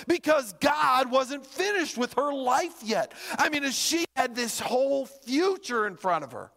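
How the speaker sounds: high, urgent appeal to the congregation; impassioned preaching. Real, not acted. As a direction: press the point with driving repetition. Preaches at full force.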